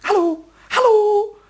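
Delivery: high, sing-out hello; coaxing call. Acted. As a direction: call sweetly to a hidden creature. Calling the mouse from its hole. (hoch lockendes Rufen)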